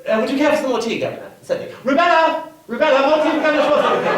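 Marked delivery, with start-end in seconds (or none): 1.8-4.1 loud, higher call to the servant. Acted. Hostess calls her maid loudly for more tea. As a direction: summon the maid across the house.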